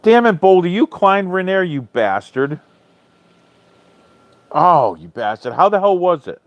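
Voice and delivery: playful jealous outburst at a friend; excited envy. Real, not acted. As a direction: cry out at a friend in jealous delight. Viewer climbed Rainier, envious outburst.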